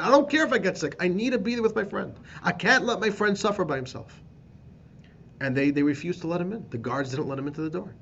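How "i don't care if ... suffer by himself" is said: much higher and louder pleading; desperate plea. Retold or imitated. Friend begging the guards to let him in, loud plea.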